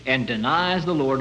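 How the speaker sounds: ordinary talk, nothing marked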